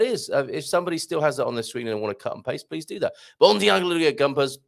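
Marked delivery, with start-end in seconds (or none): 3.4-4.6 "Bon dia, Alegria Gumpers" rattled off high and loud; rushed greeting. Real, not acted. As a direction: rush through the greeting in one go. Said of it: "Sorry, I rushed that".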